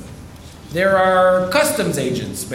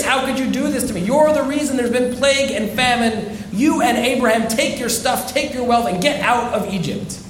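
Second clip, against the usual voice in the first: high-pitched angry rebuke, raised well above the speaker's own voice; furious Pharaoh; 6 semitones higher than usual. Retold or imitated. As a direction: scold her as a betrayed king. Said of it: "And Pharaoh is furious".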